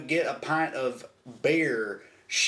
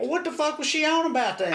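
indignant disbelief; 12 semitones higher than usual. Real, not acted. Indignant realisation.

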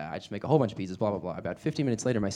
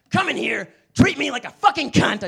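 much higher, louder snarling curse; harsh shout; 10 dB louder than usual. Retold or imitated. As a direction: spit out the last word harshly.

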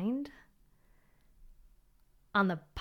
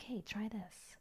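soft, near-whispered nudge; inner whisper; 14 dB quieter, slower than usual. Retold or imitated. Inner voice nudging her to try something, whispered. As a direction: breathe it like a quiet inner voice. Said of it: "a little bit more of, like, a, a whisper".

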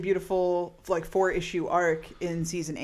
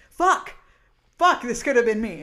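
loud, high exasperated cry; imitating a character's anguished outburst; mock frustrated outburst; 4 semitones higher, 6 dB louder than usual. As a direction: blurt it out like she's fed up. Voicing Willow's frustration.

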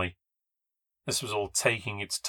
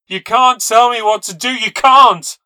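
loud, high-pitched defiant screaming; screaming tantrum; 13 semitones higher, 16 dB louder than usual. Acted. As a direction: scream in fury, stamping your feet. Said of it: "she screamed, stamping her feet".

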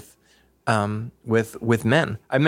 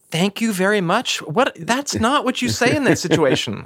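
mocking echo, pitched much higher, incredulous; exasperated mocking girlfriend; 10 semitones higher than usual. Girlfriend Laura scolding her for thanking the stranger, exasperated mockery. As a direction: mimic her foolish thanks, then scold. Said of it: "She said".

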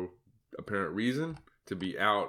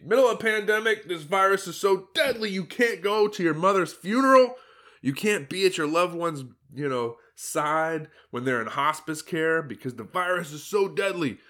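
sarcastic; 6 semitones higher, 4 dB louder than usual. Pretend. Sarcastic mock of pandemic rules.

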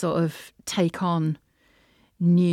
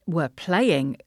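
ordinary talk

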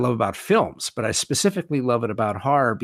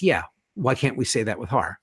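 ordinary talk

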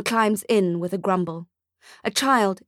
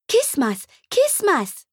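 put-on high toddler squeal, rising on the second; a tiny girl shrieking with joy; 10 semitones higher, slower than usual. Toddler at Santa's sleigh, high and squealing.

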